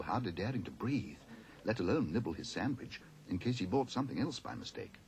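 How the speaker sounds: plain reading voice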